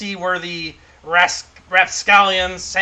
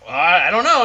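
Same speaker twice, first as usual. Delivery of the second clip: dwarf; 6 dB louder than usual. Acted. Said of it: "And he finishes".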